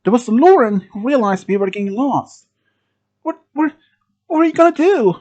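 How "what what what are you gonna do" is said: high-pitched panicky questions; acted near-tearful panic; almost crying. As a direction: stammer it out in a raised, frightened pitch. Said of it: "Lauren was almost screaming, crying".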